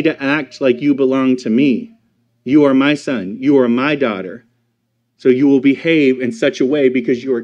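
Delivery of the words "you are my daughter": ordinary delivery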